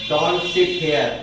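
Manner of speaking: very slow, word by word; slow model sentence. Shown as a demonstration. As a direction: spell it out slowly for the class. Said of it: "बनाया आपने".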